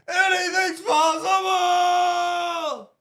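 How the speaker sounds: high, drawn-out shouted catchphrase; Kevin Garnett shout. Retold or imitated. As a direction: bellow it like a hyped champion. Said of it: "as K G would say".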